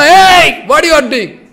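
loud retold "hey" of a guard; challenging shout. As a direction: call out sharply to stop an intruder.

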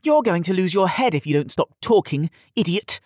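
high, scornful threat; spat insult. A narrator's reading. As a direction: spit it out with scorn. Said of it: "Yared spat".

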